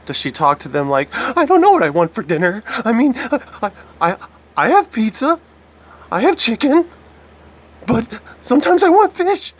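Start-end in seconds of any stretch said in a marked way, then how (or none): 1.1-9.5 rising, much higher, quavering mock voice; mock whiny victim. Commentator mocking the woman's tearful way of talking. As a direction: parody her shaky TV voice, climbing higher. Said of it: "Is this how she really sounds".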